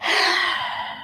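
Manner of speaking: long airy sigh; stressed sigh. Real, not acted. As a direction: let out a long, soft breath. Stressed, pausing to collect herself.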